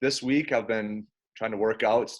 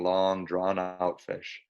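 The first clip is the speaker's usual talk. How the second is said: drawn out slowly; 2 semitones lower, much slower than usual. Acted. Word-painting a long fish.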